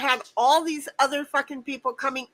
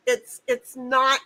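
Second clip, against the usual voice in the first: ordinary delivery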